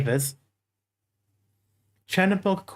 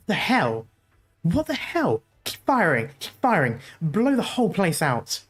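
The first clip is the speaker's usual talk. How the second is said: alarmed soldier. Acted. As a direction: shout in disbelief at the wall. Soldiers alarmed at invisible wall.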